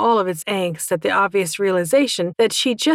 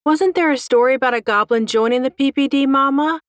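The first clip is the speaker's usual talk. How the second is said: higher-pitched youngster's suggestion; small dragon child; 6 semitones higher than usual. A narrator's reading. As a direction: pipe up as a little dragon asking mum. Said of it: "suggested one of the smaller dragons".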